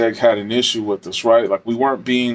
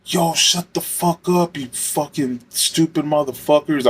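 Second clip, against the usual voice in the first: higher, slow, snarling inmate voice, put on; hostile inmate; 4 semitones higher, slower than usual. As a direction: mimic the hostile inmate slowly and sneeringly. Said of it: "this dude was like".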